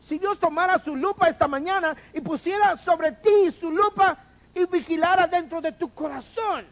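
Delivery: high, loud full-force preaching; fervent preaching. Acted. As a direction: drive the image home to the church. Builds to a climax.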